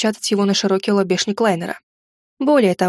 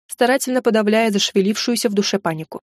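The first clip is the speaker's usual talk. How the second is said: ordinary delivery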